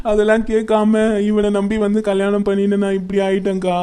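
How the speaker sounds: wailing lament broken by sobs; crying. Acted. Betrayed wife tells her friend of her parents' warning, crying. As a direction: sob out each phrase, drawing out the endings. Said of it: "அறிவு, அழாதடி".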